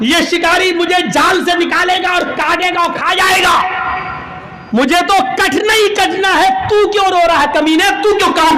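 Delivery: exasperated bird bellowing at its trapmate; angry shout. Acted. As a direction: yell at the crow in exasperation. Pigeon yells at the crow.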